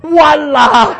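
high, loud, mock-baffled yell; comic Singlish exclamation. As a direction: shout it as hammed-up Singaporean disciples. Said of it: "all the disciples on the boat".

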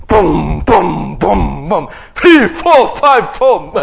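low, heavy booming giant stomps; giant's booming steps. Acted. Preacher voicing Goliath stomping toward the camp, low and booming.

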